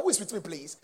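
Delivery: ordinary talk, nothing marked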